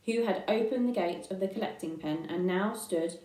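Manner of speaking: ordinary delivery, nothing marked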